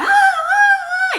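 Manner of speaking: very high shriek, laughing through it; mock scream through laughter. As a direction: imitate the crowd's scream while cracking up. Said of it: "screaming, like".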